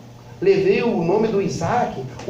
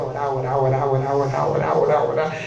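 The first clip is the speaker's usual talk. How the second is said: low, rhythmic monotone chain; droning repetition; 8 semitones lower than usual. Retold or imitated. Preacher tells how they kept praying, low droning repetition. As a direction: repeat it low and even, on and on.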